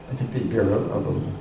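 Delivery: ordinary talk, nothing marked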